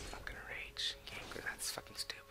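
very quiet, whispery cursing; muttered grumbling. Real, not acted. Gamer to himself about a stupid game, muttered grumbling. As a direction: grumble under your breath.

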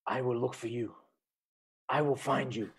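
measured, quiet movie-villain menace; a hint of a cold Liam Neeson threat. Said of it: "let me compose myself here".

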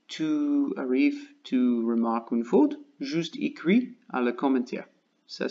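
normal talking voice

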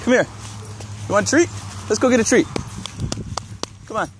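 high coaxing treat offer. Real, not acted. Coaxing the dog.

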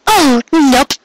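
high teasing call on the run; high coy called nope; playful call. A narrator's reading. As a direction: sing it out while darting away. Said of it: "she called".

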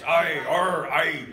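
hammed-up pirate growl. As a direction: play a cartoon pirate. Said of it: "like the pirates".

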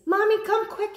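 high, loud call for mommy; monster child calling out. A narrator's reading. Little monster calls his mother, frightened. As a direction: shout for mommy from under the bed. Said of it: "he called".

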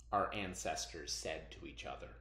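slow, hushed folk tale narration with bright peaks; storytelling read-aloud. Acted. Teacher reading a folk tale to her students, slow and hushed.

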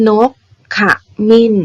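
นก ข มิ้น split into three beats; syllable-by-syllable reading. Shown as a demonstration. Teacher helping pupils write the reading, syllable by syllable. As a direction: sound out นก, ข, มิ้น with a pause between each. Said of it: "เวลาที่เราเขียนคำอ่าน เด็กๆสังเกตคำนี้ให้ดีนะ".

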